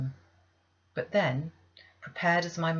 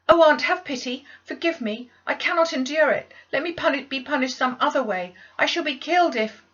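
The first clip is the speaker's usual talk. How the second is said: desperate pleading; 9 semitones higher, 10 dB louder than usual. Acted. Child begging, desperate.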